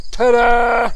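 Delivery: slow, high, loud showman's ta-da. Acted. Painter revealing his artwork to viewers, drawn-out ta-da. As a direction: unveil it like a magic trick.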